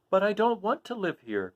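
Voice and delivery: put-on high girlish protest; Dorothy crying out. Dorothy protesting to her friends. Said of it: "cried Dorothy".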